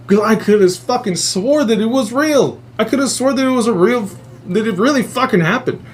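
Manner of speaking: high, wound-up disbelief; worked-up insistence. Real, not acted. Telling listeners, worked up that it felt real. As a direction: insist it was real, amped.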